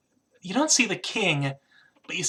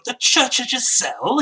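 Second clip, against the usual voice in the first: high, indignant squirrel voice; squirrel character; 8 semitones higher, 4 dB louder than usual. Acted. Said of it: "you suddenly hear this voice go".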